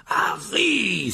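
high, drawn-out shout of a winded man; breathless exclamation. Acted. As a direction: cry out the name, out of breath. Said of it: "s'exclama Monsieur Weasley hors d'haleine". (exclamation essoufflée)

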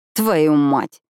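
angry curse, lightly voiced; swearing. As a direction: spit out a frustrated swear. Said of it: "ругается она".

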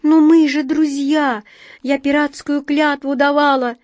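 high, loud desperate plea; pleading. A narrator's reading. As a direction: beg them in a high voice. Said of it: "Белка с мольбой протянула тоненькие лапки".

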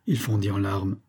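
ordinary delivery

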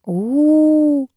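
high, long-held ooh; lingering high hoot of reaction; drawn-out "ooh". A narrator's reading. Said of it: "протянула Карина".

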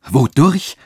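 loud, high, rising retort; indignant, cutting cry. A narrator's reading. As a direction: throw the question back sharply. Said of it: "rief sie mit schneidendem Ton".

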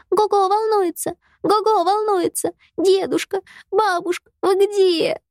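put-on high, wailing child's cry for grandparents; a small girl wailing in alarm; wailing little girl. A narrator's reading. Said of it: "она запричитала".

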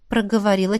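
ordinary delivery

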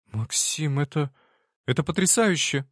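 high, delighted exclamation; exclaiming. Acted. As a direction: exclaim it in amazement. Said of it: "воскликнула она".

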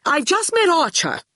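high-pitched excited burst; breathless excitement. Acted. Torquil brings Shine his news, breathless with excitement. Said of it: "breathless with excitement".

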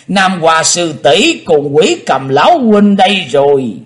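high, loud happy greeting; joyful call. A narrator's reading. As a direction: call out in delight. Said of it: "mừng rỡ kêu lên".

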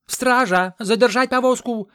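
very high, loud alarm call; shouting for guards. A narrator's reading. Aide ordering guards to stop the cart, loud alarm. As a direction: rouse the guards at full voice.

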